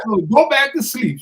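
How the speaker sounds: mild dismissive shout. Retold or imitated. Wife dismisses him, disbelieving.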